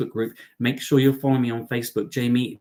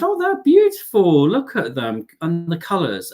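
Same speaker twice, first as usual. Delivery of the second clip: put-on gushing over baby rats; 5 semitones higher, 5 dB louder than usual. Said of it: "And I said".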